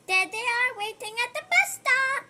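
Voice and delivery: high, loud delighted discovery; excited gasp. Acted. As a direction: gasp and point them out with joy. Reader excited to spot friends.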